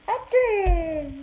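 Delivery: high, loud cheerful mock sneeze; happy fake sneeze. Shown as a demonstration. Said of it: "The happy one".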